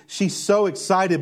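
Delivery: ordinary delivery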